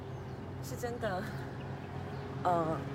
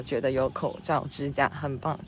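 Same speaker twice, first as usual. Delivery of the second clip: usual speaking voice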